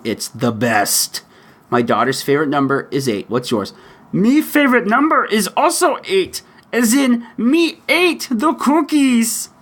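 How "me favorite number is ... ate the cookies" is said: very high monster puppet voice; big gleeful monster impression, stretched at the end; Cookie Monster. Host answers a parent's favorite-number question, Cookie Monster impersonation. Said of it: "do my best Cookie Monster imp-, impersonation".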